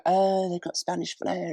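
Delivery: high, airy marketing-speak parody of a car launch; mock launch voice. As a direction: lift it up like a smug press presenter selling flair. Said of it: "when they'll go".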